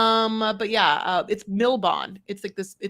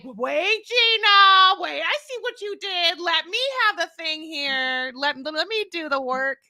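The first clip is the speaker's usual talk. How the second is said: high, firm host voice cutting in; host Sean; 7 semitones higher than usual. Retold or imitated. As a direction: take back control of the room. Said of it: "Sean's like".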